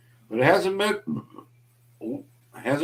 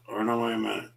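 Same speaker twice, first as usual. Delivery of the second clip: ordinary delivery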